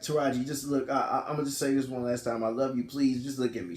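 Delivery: soft, low, heartfelt appeal; acted-out subdued declaration of love; retold last plea. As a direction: plead quietly one last time. Husband's last plea before signing.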